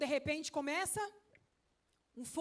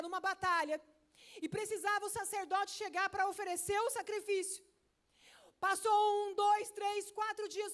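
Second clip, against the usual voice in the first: high, loud, driving sermon voice; fiery pulpit delivery rising above the crowd; full-force preaching; 7 semitones higher, 8 dB louder than usual. Acted. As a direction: preach it out to the whole church. Preacher tells Saul's story.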